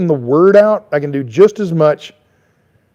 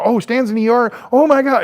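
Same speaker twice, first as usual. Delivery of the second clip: higher, mock-panicked gasp of worried fans; mock alarm; 8 semitones higher than usual. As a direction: imitate fans freaking out about Stan. Mock fans panicking over hospital.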